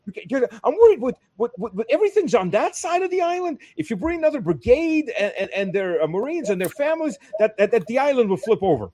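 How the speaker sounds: high, silly voice for the worried senator; mocking the senator. Mocking the senator who fears the island will flip, high and silly. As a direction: ham up a clueless politician's fret.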